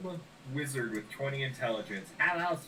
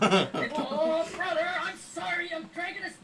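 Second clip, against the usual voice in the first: much higher, sheepish little brother; high apologetic character voice; 13 semitones higher, 4 dB louder than usual. Acted. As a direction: squeak out a sorry apology.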